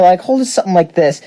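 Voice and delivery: ordinary delivery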